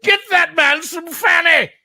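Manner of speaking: high, slow, laughing call for fanny; laughing mock cheer. Real, not acted. Host mocking his drunk friend on stream, high laughing cheer.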